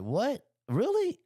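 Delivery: sharp, very high shocked questions; retold disbelief. Hearing about the extra fee, a high disbelieving squeak. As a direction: voice shoots up in mock disbelief. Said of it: "And in my head, like".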